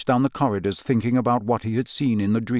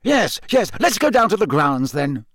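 higher, hurried yes yes; impatient minister giving in; 6 semitones higher than usual. A narrator's reading. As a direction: brush it off impatiently. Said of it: "said Fudge impatiently".

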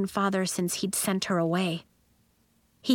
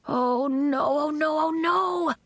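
dismay climbing steadily higher; crying out; 7 semitones higher, slower than usual. A narrator's reading. Elliot realizes he iced the instruments, crying out. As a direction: cry out, each repeat more panicked. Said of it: "Elliot cried".

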